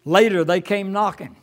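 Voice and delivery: ordinary talk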